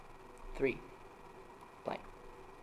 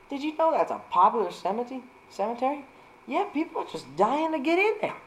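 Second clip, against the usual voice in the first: hammed-up dad; 11 semitones higher than usual. Dad voice at the graveyard.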